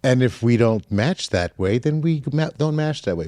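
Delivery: normal talking voice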